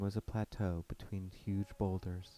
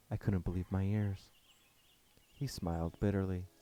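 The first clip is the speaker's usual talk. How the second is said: usual speaking voice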